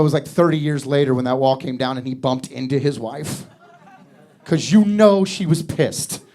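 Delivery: normal talking voice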